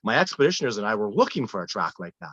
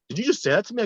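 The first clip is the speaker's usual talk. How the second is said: offended disbelief; 10 semitones higher, much faster than usual. Retold or imitated. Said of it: "He was like".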